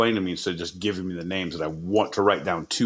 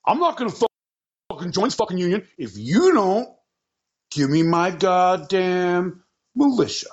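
bratty mock Mason; 9 semitones higher than usual. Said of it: "And Mason's like".

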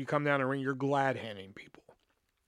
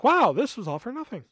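mock dismay; 8 semitones higher, 7 dB louder than usual. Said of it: "So I was just like".

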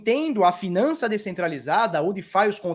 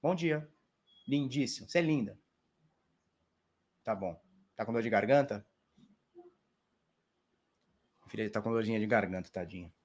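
tender talk to daughter; 10 semitones lower, 12 dB quieter than usual. Real, not acted. Talking softly to his little daughter. (pai falando baixinho e carinhoso com a filha doente)

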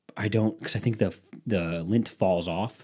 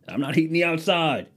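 higher-pitched mock exasperation; hammed-up dad complaint, pitched up; exasperated homeowner; 8 semitones higher than usual.

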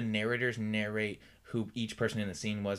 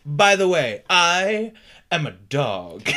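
loud; 5 semitones higher, 11 dB louder, much slower than usual. Pretend. Mock fourth wall line.

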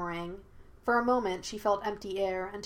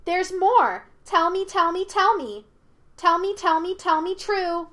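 put-on high, loud childlike chant; sing-song; 10 semitones higher, 8 dB louder than usual. A narrator's reading. Monkey spirit demands the truth, in sing-song. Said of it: "sing-songed the creature".

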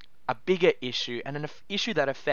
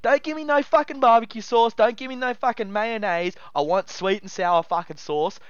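worked up; 6 semitones higher than usual. Real, not acted. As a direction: spit it out in outrage. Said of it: "I'm getting real worked up over it".

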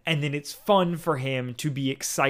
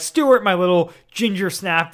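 doting gush; 5 semitones higher, 6 dB louder than usual. Acted.

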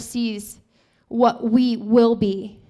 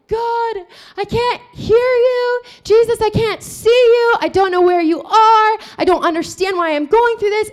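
stretched-out, raised pleading; crying out; 7 semitones higher, 4 dB louder than usual. Retold or imitated. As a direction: imitate a desperate cry to God. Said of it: "she's crying out and screaming".

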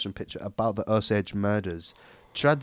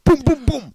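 high, loud boom sounds; mock explosions; 7 dB louder than usual. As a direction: blast out the booms. Gun sound imitation.

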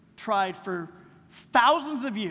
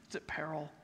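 hushed warning; 7 semitones lower, 13 dB quieter than usual. Real, not acted. Dropped whispered warning.

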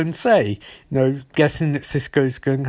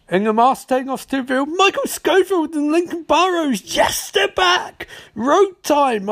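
high-pitched fan excitement building to a squeal; gleeful high-pitched announcement; pumped up; 10 semitones higher than usual. Real, not acted. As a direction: hype the big reveal, thrilled. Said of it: "Oh, I'm so pumped".

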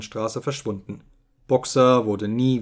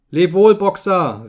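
loud, raised, drawn-out farewell call; calling out in chorus; 6 semitones higher, 8 dB louder, slower than usual. A narrator's reading. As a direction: call a slow goodbye like a crowd. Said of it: "riefen sie im Chor".

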